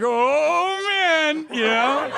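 swooning at the dark room; giddy excited gasp. Retold or imitated. Comedian recalling the Chippendales show, giddy high excitement. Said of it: "I was like".

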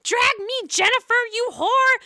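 high, loud hammed-up taunting; mock fight shout. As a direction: yell it as an imitated catfight challenge. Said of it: "Is it gonna be a".

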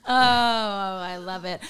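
ordinary delivery

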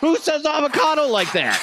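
high, loud disbelief; incredulous outburst. Real, not acted. Reacting to Salt Bae's avocado clip, incredulous. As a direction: cry out in amused disbelief.

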